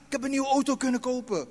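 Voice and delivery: boastful car owner. Retold or imitated. Preacher mimics someone bragging about a car, mock boast.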